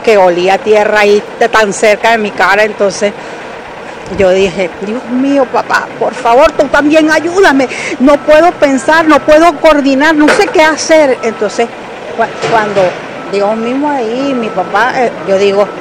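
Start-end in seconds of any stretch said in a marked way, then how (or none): 4.8-11.2 desperate prayer. Retold or imitated. Desperate prayer while trapped.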